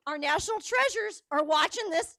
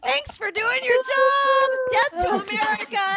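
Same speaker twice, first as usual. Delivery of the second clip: mock hype shout; 8 semitones higher, 5 dB louder than usual. Host hypes up the Zoom crowd.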